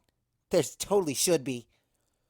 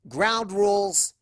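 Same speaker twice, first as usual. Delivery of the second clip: shouting; 7 semitones higher, 7 dB louder, much slower than usual. Acted. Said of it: "I shout at the front door".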